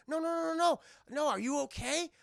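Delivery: high, quick string of no's; concerned reassurance. Acted. Blind man checks on the stranger, concerned.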